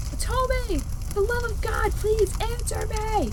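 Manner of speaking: high, strained calling out; desperate shouts. Acted. Hero searching burning rooms shouts for Kotomi, desperate. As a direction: beg her to answer, shouting. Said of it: "my desperate shouts".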